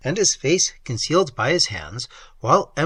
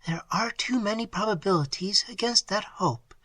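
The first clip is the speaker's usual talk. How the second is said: put-on higher, hushed, almost whispered young woman; Emily's voice; 7 semitones higher, 4 dB quieter than usual. A narrator's reading. A young woman answering her lover in a hush. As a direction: breathe her doubts softly. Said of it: "said Emily".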